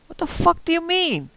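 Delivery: high, loud, frantic yell into the phone; shrieking at the operator down the phone; panicked yell. Acted. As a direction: scream it in disbelief.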